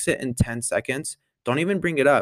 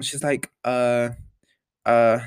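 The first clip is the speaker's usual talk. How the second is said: usual speaking voice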